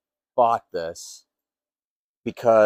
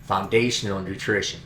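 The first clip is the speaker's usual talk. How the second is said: upbeat scripted ad read, lightly performed; friendly commercial voice; sponsor ad read; 2 semitones higher than usual. Acted. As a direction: sell the product like a spokesman, breezy and rehearsed.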